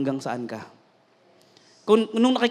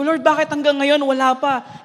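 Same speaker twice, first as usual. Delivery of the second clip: high-pitched plaintive plea; retold frustrated prayer; pleading complaint; 7 semitones higher, 4 dB louder than usual. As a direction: whine the question up to God. Retells his complaint to God.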